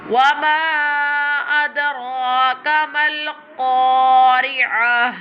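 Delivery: slow chant with a full, heavy ra in "adraka"; bold ra. Shown as a demonstration. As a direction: stretch "ma", then a bold ra in "adraka". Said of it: "this one ra will be bold like this".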